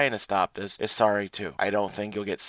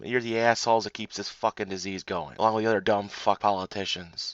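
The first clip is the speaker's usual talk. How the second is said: ordinary talk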